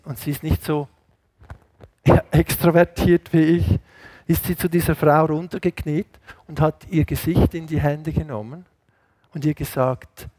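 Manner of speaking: normal talking voice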